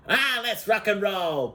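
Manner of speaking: high, slow rallying cry; imitated war cry. Group setting off in the truck, mock rallying cry. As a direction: stretch it out like a band setting off.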